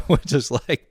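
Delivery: ordinary talk, nothing marked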